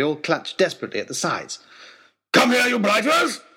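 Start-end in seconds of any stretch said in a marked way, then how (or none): 2.3-3.4 loud, high angry bellow at the geese; shouting. Acted. Baronet shouting at the passing geese, high loud bellow. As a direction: roar it out, fists flailing. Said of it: "he was shouting".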